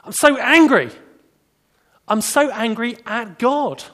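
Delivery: high, loud cry of anger at God; angry outburst. Retold or imitated. Troubled man to his pastor, loud angry cry. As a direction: blurt it out, hurt and furious. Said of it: "obviously in distress".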